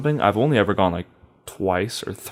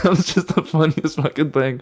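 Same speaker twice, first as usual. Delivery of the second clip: higher, giggly real laughter in the words; can't stop laughing; 5 semitones higher than usual. Real, not acted. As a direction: talk through helpless laughter. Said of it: "I can't stop laughing".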